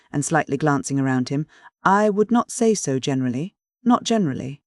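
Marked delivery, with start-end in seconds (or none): none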